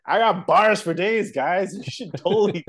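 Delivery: excited boast. Real, not acted. Hyping himself as rapper.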